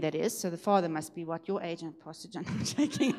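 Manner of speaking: normal talking voice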